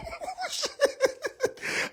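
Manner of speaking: shrill-pitched burst of laughter; high squealing laugh. Real, not acted. Comic laughing at his own fashion joke, high squealing laugh.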